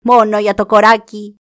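a loud raven's cry; shout. Acted. Raven shouting to claim the fat. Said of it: "Τότε φώναξε".

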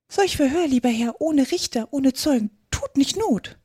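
high-pitched mouse reciting rhyme; pleading little mouse. Acted. Mouse objects to the dog's trial, high-voiced. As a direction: protest in small, high, rhythmic phrases.

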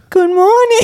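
put-on high sing-song baby talk with a laugh; cutesy baby voice. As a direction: coo it sweetly, mocking. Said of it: "she's like".

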